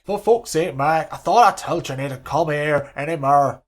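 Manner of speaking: put-on loud, exasperated Scottish-Irish hybrid accent; a furious Sir Alex in a shaky Scottish-Irish accent. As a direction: bark it out as a cross Scottish-Irish manager. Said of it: "Sir Alex has got an Irish accent".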